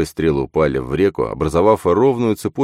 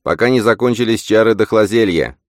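higher voice, mildly raised; insistent, pitched-up call; urging; 6 semitones higher, 4 dB louder than usual. A narrator's reading. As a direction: warn them to hurry. Old woman urges swimmers on.

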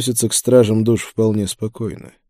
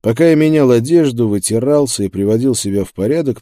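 ordinary delivery

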